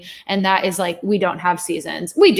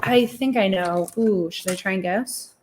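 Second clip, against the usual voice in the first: ordinary delivery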